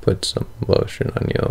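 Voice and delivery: ordinary talk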